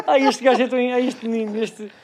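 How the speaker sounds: high, laughing exclamation; amused laughing. Real, not acted. Teasing his co-host over the joke, laughing. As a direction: laugh through the affectionate complaint.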